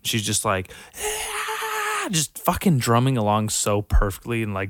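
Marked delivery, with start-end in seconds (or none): 1.0-2.1 high mock rock scream; imitated scream. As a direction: yell like the drumming girl. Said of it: "She's, like, screaming".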